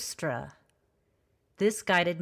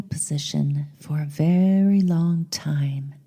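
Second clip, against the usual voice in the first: measured meditation voice with long pauses; guided meditation; 6 dB louder than usual. Acted. Host leading listeners on a journey to the goddess, meditative. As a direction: guide the listener inward, lingering between phrases.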